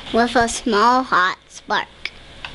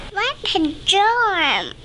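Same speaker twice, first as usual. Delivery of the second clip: high, bright bird voice; twittering wren; 9 semitones higher, slower than usual. Acted. Wren exclaiming as birds flutter in, high twitter. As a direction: chirp it like a little wren. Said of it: "twitters wren".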